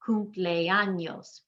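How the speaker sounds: very slow, stretched "Cumpleaños"; "Cumpleaños" drawn out as four syllables. Shown as a demonstration. Teacher showing how to count, "Cumpleaños" slowly in four parts. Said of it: "You can count syllables".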